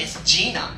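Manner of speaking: "Gina" pronounced JEE-nuh; "Gina" with a long ee in the first syllable; corrected name. Retold or imitated. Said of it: "She's like".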